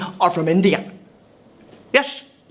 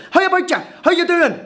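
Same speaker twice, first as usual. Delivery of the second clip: over-keen neighbourly hello; eager blurted greeting; 9 semitones higher than usual. Retold or imitated. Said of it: "I just blurted out".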